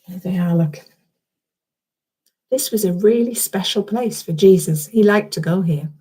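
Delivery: ordinary delivery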